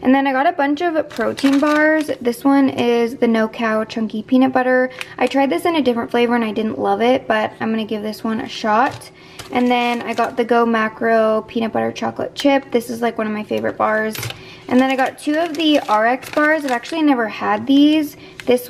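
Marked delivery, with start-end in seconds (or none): none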